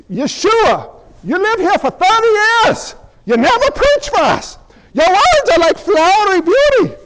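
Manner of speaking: high, hammed-up Irish townsfolk; incredulous villagers with comic Irish accents.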